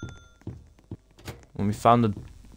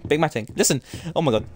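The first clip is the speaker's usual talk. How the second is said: normal talking voice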